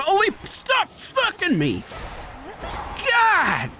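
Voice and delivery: ordinary delivery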